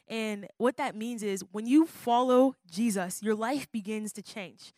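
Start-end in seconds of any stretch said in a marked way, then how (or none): none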